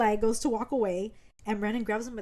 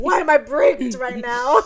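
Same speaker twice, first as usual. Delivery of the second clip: slow, loud wail breaking into laughter; laughing mock dismay; 10 dB louder, slower than usual. Friend retells Brandon's dismay at himself, laughing loudly. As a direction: crack up while imitating his dismay. Retold dismay, teller cracking up.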